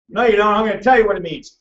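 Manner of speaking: ordinary delivery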